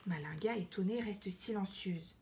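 ordinary delivery

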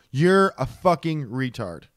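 usual speaking voice

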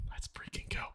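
soft whispered cheer; whispered hype. Real, not acted. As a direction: hype it under your breath.

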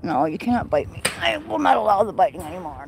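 ordinary delivery

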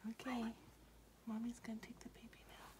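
soft quick whisper. Real, not acted. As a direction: hush it under the breath. Mother whispering near sleeping baby.

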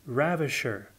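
ordinary delivery

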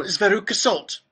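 put-on high, loud girl's voice; Veruca Salt. A narrator's reading. Next girl introducing herself at the gates, high and loud.

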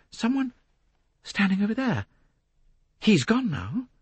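high, halting alarm; startled Jacqueline. A narrator's reading. Jacqueline spotting someone standing over there, high and startled. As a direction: report it with caught attention.

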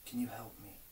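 soft hushed voice, first words unvoiced; quietly asking God for help; whispered prayer. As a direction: murmur it close to God's ear. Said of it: "whisper in his ear and say".

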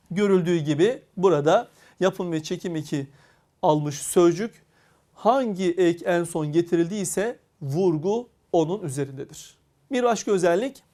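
ordinary delivery

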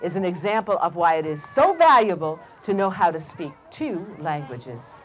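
low, measured Mama Mouse; wise mother mouse. Acted. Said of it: "And she said".

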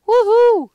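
very high, loud cheer; excited whoop. Real, not acted. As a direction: celebrate making third place. Reaching third place.

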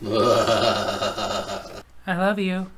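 low and very slow; very slow, low drawn-out. Retold or imitated. Wife repeating it a third time, very slow and low. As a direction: drag it out low and heavy.

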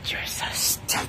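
unvoiced hissing whisper-shout; whispered insult. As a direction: spit it out under your breath.

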